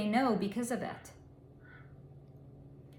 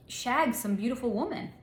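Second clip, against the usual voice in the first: usual speaking voice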